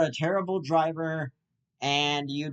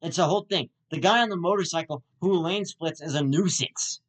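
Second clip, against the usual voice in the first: ordinary delivery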